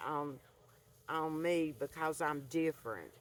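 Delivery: ordinary talk, nothing marked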